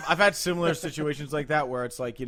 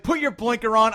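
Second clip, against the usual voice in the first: raised, exasperated command; retold angry order; 6 semitones higher, 5 dB louder than usual. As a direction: scold a driver at high pitch. Retold argument with a driver.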